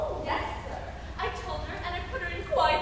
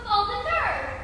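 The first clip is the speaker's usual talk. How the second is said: spluttering outrage; 5 semitones higher, 5 dB louder, slower than usual. Acted. Jilted lover's outrage.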